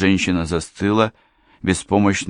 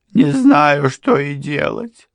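sobbing; 9 semitones higher, 6 dB louder, slower than usual. A narrator's reading. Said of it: "всхлипнула она".

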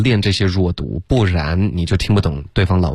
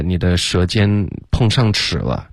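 usual speaking voice

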